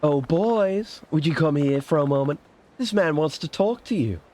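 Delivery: high, singsong summons; mother calling sons. Acted. Mother calls her boys over, lilting high role voice.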